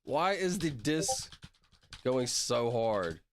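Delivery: slow, stretched repeat of the question; drawn out. Acted. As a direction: drag every word out for effect.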